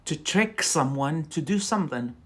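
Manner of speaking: normal talking voice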